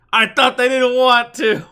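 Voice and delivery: high, loud, drawn-out protest; incredulous shout. Acted. Player reacting to misheard burn order, high incredulous shout. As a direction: shout it in comic disbelief. Blurts out misheard plan, laughing after.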